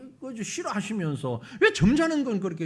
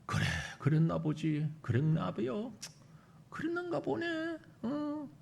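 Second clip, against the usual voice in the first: mock indifferent murmur; 4 semitones lower, 7 dB quieter than usual. Mimics the passive congregation.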